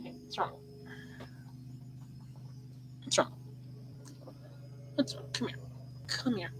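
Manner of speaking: soft, hushed comfort to a scared cat; gentle whisper to the pet; soothing the pet. Real, not acted. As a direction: coax the frightened cat close, softly.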